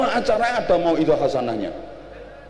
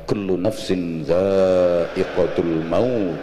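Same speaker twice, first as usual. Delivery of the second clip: MC voice; 11 semitones lower than usual. Retold or imitated. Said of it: "M C ne ngomong".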